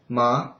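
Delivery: MA rising like a question; second tone. Shown as a demonstration. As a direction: lift the pitch through MA. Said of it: "If you change it to second".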